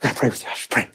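ordinary talk, nothing marked

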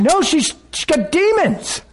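high, drawn-out mock verdict; mock alarm. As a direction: pronounce it high, like a scandalized onlooker. Acting out people judging the woman.

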